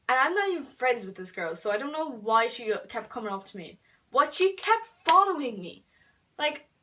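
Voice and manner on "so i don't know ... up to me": ordinary delivery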